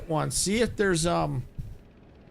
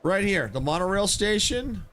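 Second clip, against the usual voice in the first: ordinary talk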